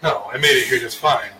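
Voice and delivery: ordinary talk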